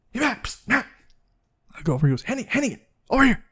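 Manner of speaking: soft, urgent stage whisper; hushed call from hiding. Retold or imitated. Friend hiding between buildings calls Matt, hushed and urgent. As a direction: hiss it from the shadows, hushed.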